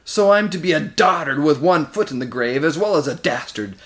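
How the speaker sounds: put-on loud, affronted outburst from an old warlord; roaring. A narrator's reading. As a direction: roar it in wounded pride. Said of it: "roared Moraz".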